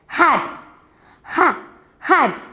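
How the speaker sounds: "ha, hat" with the H split off then the whole word; careful pronunciation. Shown as a demonstration. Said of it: "pronounce it correctly".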